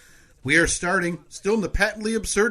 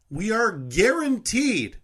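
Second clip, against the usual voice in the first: dramatic announcer; 6 semitones higher, slower than usual. Acted.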